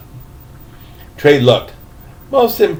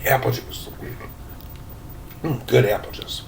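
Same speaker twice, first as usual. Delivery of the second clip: hushed aside; 5 semitones lower, 12 dB quieter than usual. Real, not acted. Reader aside after throat clear.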